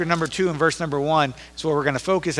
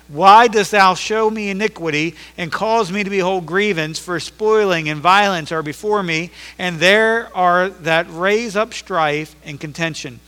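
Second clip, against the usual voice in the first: slow, solemn, louder scripture read; 3 semitones higher, 4 dB louder than usual. Acted. Lift each old word out slowly. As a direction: pastor reading the prophet's lament to the congregation, slow and solemn. Reads scripture in King James.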